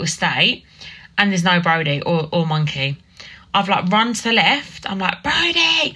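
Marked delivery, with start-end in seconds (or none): 5.2-6.0 put-on high panicked calling of a child's name; retold frantic scream. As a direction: call him like he's vanished. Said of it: "Screaming, top of my voice".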